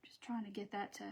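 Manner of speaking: whispered to herself while working; whisper, focused on the task. Real, not acted. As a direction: murmur under breath while concentrating. Concentrating on work.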